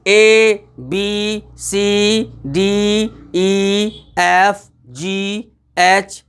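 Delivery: ए, बी, सी chanted slowly, each letter held; alphabet recitation. Shown as a demonstration. Teacher mimics reading the alphabet in class, alphabet recitation. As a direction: draw out every letter name evenly. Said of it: "जैसे हम लोग alphabet में पढ़ते ना".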